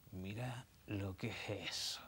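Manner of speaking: low, soft, slow leering murmur; lustful. A narrator's reading. The devil eyes the passing photographer, lustful and hushed. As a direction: savour each word with lust.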